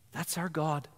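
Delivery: usual speaking voice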